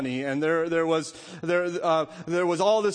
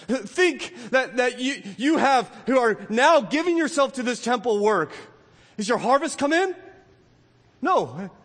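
higher and a little louder, pressing the point; fervent preaching; 6 semitones higher, 4 dB louder than usual. Acted. Pastor urges the congregation to think, fervently. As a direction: drive it home to the pews.

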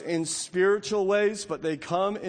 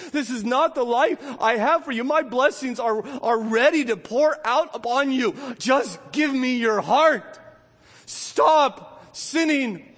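pleading God; 6 semitones higher, 3 dB louder than usual. Acted. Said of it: "And God is saying".

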